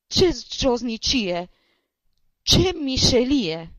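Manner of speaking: crying out his humiliation; tearful wail. A narrator's reading. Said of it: "hohot de plâns".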